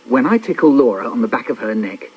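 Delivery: usual speaking voice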